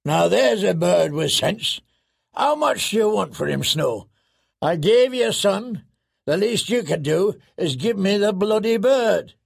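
high, blustering wildling haggling; boisterous, half-amused demand; rowdy warrior pressing for a bargain; Tormund. A narrator's reading.

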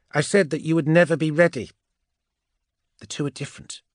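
high, insistent witch voice; emphatic high-voiced counsel; Andromeda, firm reassurance. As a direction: correct him firmly, pitched high. Andromeda firmly corrects Archamus.

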